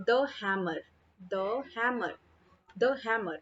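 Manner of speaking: "the hammer" with short द, duh. Shown as a demonstration. Teacher showing the before consonant sound, "the hammer" with द. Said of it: "कहाँ पे हम द पढ़ें".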